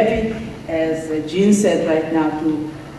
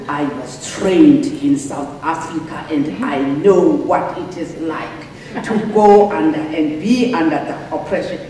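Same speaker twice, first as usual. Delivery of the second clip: put-on deep Xhosa accent; 3 semitones lower than usual. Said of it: "get into this very deep Xhosa accent".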